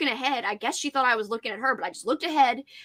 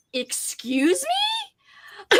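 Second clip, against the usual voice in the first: high, drawn-out indignant challenge; indignant excuse me; 12 semitones higher, much slower than usual. Retold or imitated. Standing up to the yelling lady, drawn-out indignant excuse me. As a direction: stretch it out, affronted. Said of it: "I said".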